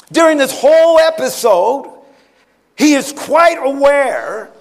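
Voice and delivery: urgent, driving sermon delivery; impassioned preaching. Acted. Preacher telling church of the arrest in Gethsemane, impassioned. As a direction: build the story with rising intensity.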